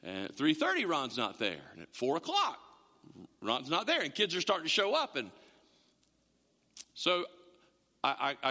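ordinary talk

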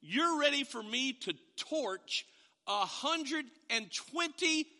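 high, slowing, swelling rebuke; God's rebuke. Retold or imitated. God rebuking Jonah over the plant, high and slow. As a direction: press Jonah slowly. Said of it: "He said".

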